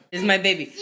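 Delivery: normal talking voice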